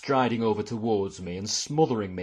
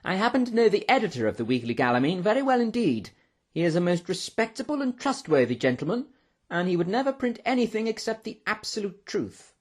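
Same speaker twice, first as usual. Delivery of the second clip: high-pitched, self-important professor; patronising; 9 semitones higher than usual. A narrator's reading. Professor defending the editor to a lady, patronising. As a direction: lecture her in a high, superior voice. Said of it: "in a rather patronising tone".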